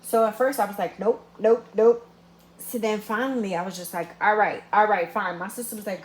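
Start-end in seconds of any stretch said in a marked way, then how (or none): 1.0-2.1 loud, firm nope nope nope; loud refusal. Retold or imitated. Refusing her friend's pushing, loud emphatic nopes. 4.2-5.4 loud reluctant giving in. Retold or imitated. Giving in to pressure.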